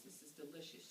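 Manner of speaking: hushed, breathy whisper of pleasure; whispered aside. Retold or imitated. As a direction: whisper it like a secret treat. Honey sipping her drink.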